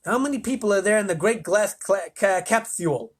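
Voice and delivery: high, lisping interrogation; rasping President. A narrator's reading. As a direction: demand it with a lisped rasp. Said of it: "rasped the President".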